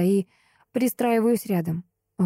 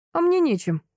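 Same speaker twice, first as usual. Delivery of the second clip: pleased; 10 semitones higher than usual. Acted. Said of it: "довольно говорит он".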